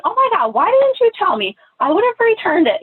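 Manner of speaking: high, loud gushing shop assistant; customer service mode. Retold or imitated. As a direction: gush like an eager salesclerk. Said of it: "customer service mode".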